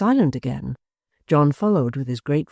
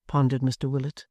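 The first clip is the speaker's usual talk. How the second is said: plain reading voice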